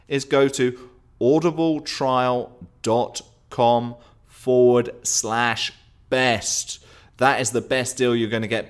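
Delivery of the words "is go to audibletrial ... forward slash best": spelled out slowly for note-taking; slow, careful dictation; slow and clear. Shown as a demonstration. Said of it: "If you didn't hear me that time and you need to get a pen out".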